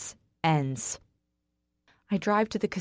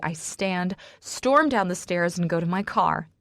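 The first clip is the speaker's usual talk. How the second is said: usual speaking voice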